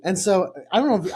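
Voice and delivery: ordinary talk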